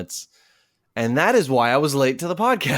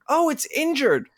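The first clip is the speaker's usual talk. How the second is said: much higher imitating voice; a lightly mocked high-pitched woman; high voice imitation; 12 semitones higher than usual. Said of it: "she was like".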